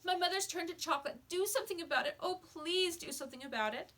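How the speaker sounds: put-on boy begging the storekeeper to save his mother; frantic pleading. A narrator's reading. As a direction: plead urgently for help.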